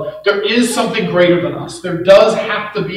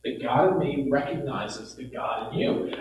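ordinary talk, nothing marked

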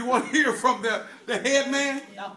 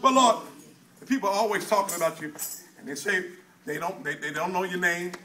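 quiet prayer; 5 semitones lower, 4 dB quieter than usual. Acted. Prays softly to the Lord.

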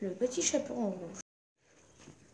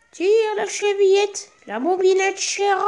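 put-on wolf faking a gentle old granny, much higher; wolf softening his voice; 9 semitones higher, 6 dB louder than usual. Wolf in bed calls to the girl, posing as a much higher grandmother. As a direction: soften it into a high, sweet grandmother's call. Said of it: "en adoucissant un peu sa voix".

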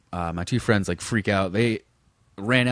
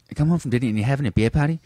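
quick, higher indignant dad impression; dad's Massachusetts accent; 4 semitones higher, faster than usual. Dad coming home from dinner, accented mock indignation. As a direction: imitate dad's New Bedford accent, hurried and questioning. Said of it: "he said".

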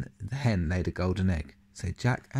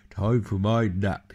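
sleepy giant; 6 dB louder than usual. Acted. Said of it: "he said".

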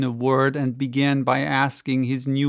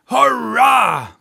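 high, loud cheer from the crowd; cried out; 10 semitones higher, 7 dB louder than usual. Acted. Maston cheering the speaker from the audience, loud and high. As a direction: burst out with a joyful hurrah. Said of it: "cried one voice".

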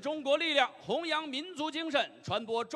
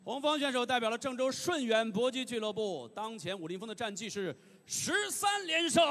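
ordinary delivery

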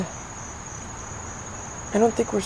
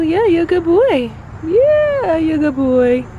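much higher, louder squeal; 11 semitones higher, 5 dB louder than usual. Real, not acted. Praising cat Boo, squeaky high baby talk. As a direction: gush over the cat.

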